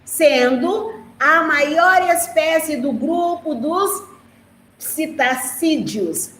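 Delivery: slow, careful read-aloud; teacher reading the book's sentence to her class; reading from the text. Shown as a demonstration. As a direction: read it slowly so the children follow. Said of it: "fala assim".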